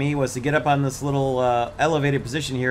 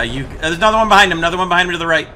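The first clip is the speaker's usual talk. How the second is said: loud, fast warning shout; urgent callout; 6 semitones higher, 8 dB louder, much faster than usual. Real, not acted. Urgent callout of enemy in combat.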